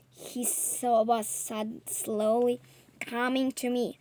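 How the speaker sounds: ordinary delivery, nothing marked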